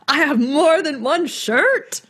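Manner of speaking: put-on indignant kid voice.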